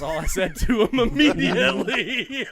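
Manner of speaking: high, loud, slowed through real laughter; laughing. Real, not acted. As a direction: break up laughing at your own story. Cracks up at own story.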